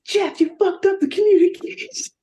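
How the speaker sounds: angry boss yell. Acted. Domax storms in yelling.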